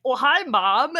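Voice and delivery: high, loud, bright puppy hello; excited dog greeting. Retold or imitated. Dog spotting mom at daycare, excited high greeting. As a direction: squeal it like a thrilled pup.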